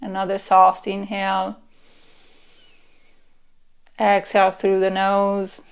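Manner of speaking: guided meditation. Acted. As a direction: lull them into relaxing.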